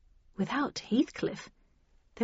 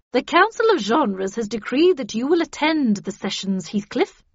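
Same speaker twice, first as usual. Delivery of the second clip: loud, higher, stern official decree; cold command; 4 semitones higher, 8 dB louder than usual. A narrator's reading. Havisham orders Heathcliff to attend the sessions, coldly. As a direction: lay down the order coldly and forcefully. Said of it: "said Havisham coldly".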